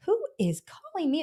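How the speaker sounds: high-pitched, exasperated disbelief; incredulous. Retold or imitated. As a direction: protest an absurdly early call. Retells disbelief at early morning call.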